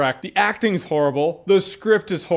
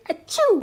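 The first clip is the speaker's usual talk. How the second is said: mock sneeze; 13 semitones higher than usual. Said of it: "literally saying".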